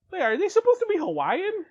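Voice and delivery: high, loud puzzled question; puzzled cousin. Retold or imitated. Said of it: "she leaned over, it's like".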